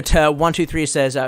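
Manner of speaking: ordinary talk, nothing marked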